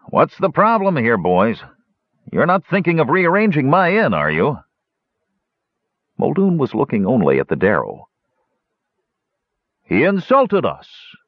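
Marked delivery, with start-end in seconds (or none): 0.1-4.6 higher, lightly voiced jovial innkeeper; a hint of a firm host calming brawlers; innkeeper breaking up a fight. 9.8-11.2 higher, slow Darrow voice; aggrieved claim. Acted. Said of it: "one of them claimed".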